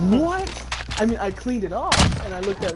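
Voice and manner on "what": mock stunned high yelp of "What?"; shocked what. Teller reliving seeing the toilet, high surprised yelp. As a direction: squeak "What?" in disbelief.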